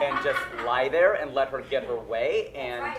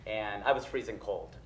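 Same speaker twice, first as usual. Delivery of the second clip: ordinary delivery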